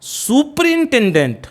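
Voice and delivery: सुपरिटेंडेंट slow and high with a long "soo-preen"; slow, stretched "soo" in सुपरिटेंडेंट; long-vowel सुपरिटेंडेंट. Shown as a demonstration. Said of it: "और क्या है".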